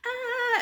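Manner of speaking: a sudden high strangled ah; dying plant gasp. Retold or imitated. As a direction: squeak it out like a wilting plant. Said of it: "they're like".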